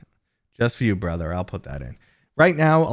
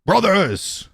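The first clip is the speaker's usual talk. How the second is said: loud, higher battle cry; Space Marine shout; 10 semitones higher, 9 dB louder than usual. Acted. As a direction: bellow it at the squad. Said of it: "when I did this".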